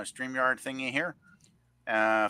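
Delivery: usual speaking voice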